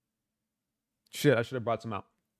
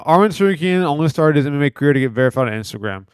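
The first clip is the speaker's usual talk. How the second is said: reading aloud; 10 dB louder than usual. Acted. Reads post aloud.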